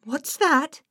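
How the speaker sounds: high, fearful question; alarmed cry. Acted. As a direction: ask in boyish alarm. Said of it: "Nate cried".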